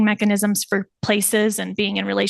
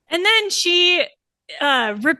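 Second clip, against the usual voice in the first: usual speaking voice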